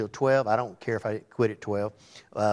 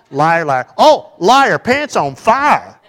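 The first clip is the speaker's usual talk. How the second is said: loud playground chant, slowed at the end; liar liar shout; 5 semitones higher, 13 dB louder, much slower than usual. Shown as a demonstration. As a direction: belt out the schoolyard taunt, stretching "pants on fire". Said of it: "playground taunt shouted".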